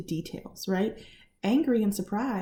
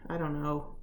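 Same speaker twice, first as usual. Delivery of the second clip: low, soft shrug of a reply; imitating someone upset who won't explain; withdrawn non-answer; 6 semitones lower, 5 dB quieter than usual. Upset husband brushing off wife's question, withdrawn and low.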